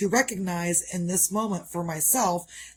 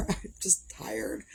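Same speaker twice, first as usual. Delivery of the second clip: tired whisper; 7 dB quieter, much slower than usual. Real, not acted. Tired vlogger trailing off.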